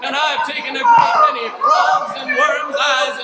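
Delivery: loud, high, manic declaration; mad shouting grandpa. Acted. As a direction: rave like a crazed old man. Grandpa raves with hook.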